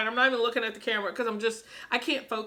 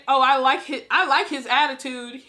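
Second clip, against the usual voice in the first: ordinary talk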